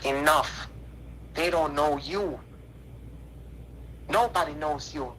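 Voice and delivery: lightly voiced God calling her name firmly; a hint of a grave divine voice. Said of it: "Until God said".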